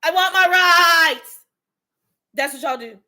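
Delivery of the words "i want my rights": loud, slow, higher mock demand; hammed-up protest chant, loud and drawn out; mock yelling. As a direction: yell it like a protester. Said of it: "what y'all be yelling back".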